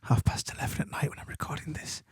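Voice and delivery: soft late-night whisper. Real, not acted. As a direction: breathe the words, no voice at all. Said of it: "I'm just gonna whisper".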